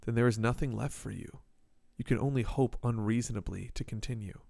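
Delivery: somber reflective reading; second person narrator. A narrator's reading.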